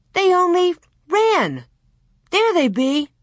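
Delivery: high, emphatic correction; defensive boy. A narrator's reading. Almanzo defends his calves to Pierre, high and insistent. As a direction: insist like a boy saving face.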